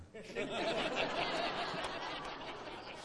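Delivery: long airy high-pitched laugh. Real, not acted. Preacher laughing at his dad's joke, high and airy. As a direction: laugh it off, amused.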